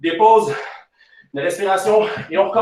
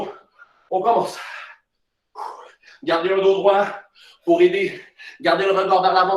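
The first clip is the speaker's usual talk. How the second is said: ordinary talk, nothing marked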